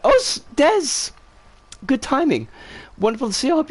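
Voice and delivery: sudden high-pitched hello, caught off guard; surprised greeting. Real, not acted. Spots a friend mid-story.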